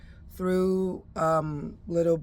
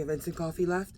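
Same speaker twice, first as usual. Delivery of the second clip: ordinary delivery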